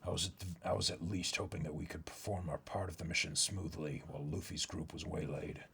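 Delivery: a deep, hushed voice weighing a desperate plan; low, grave character voice. Acted. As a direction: speak low and grim about a hopeless mission. Reads a manga character aloud.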